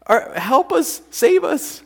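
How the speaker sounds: high, loud cry for help; mock panic. Disciples begging Jesus to save them from drowning, mock panic. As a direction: plead frantically, hamming up the terror. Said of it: "the disciples are freaking out".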